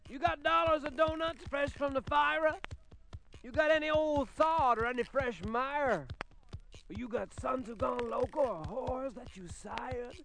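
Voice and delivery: high, pushy hawker's voice; the voice on the wire. Acted. Voice over the wire pushing its wares, high and insistent. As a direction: rattle off offers, pitched high. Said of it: "said the voice".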